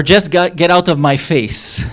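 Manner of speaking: loud, rising push-away with a laugh; half-joking get-out; mock angry brush-off. Shown as a demonstration. Teacher showing an irritated tone. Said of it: "Context and tone of voice shape the meaning".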